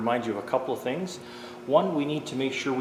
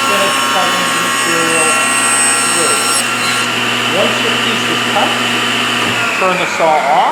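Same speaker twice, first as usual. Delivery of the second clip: loud raised voice over machine noise; shouting over table saw; 6 semitones higher, 14 dB louder than usual. Real, not acted. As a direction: project your voice above the running saw. Instructor talking while saw runs.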